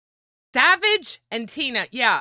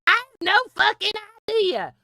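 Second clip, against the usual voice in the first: whiny complaining quote; 9 semitones higher than usual. Retold or imitated. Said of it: "I hear so many people".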